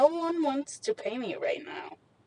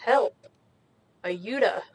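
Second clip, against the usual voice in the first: English accent; 3 dB louder, slower than usual. Real, not acted. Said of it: "said it with an English s-, accent".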